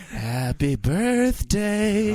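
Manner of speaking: very slow, hammed-up sultry birthday croon; drawn-out mock sultry tease; failed seductive. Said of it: "that was supposed to be sexy".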